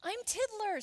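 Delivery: high, loud introduction; eager storybook youngster; little fish voice. Acted. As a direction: announce yourself brightly as a small fish.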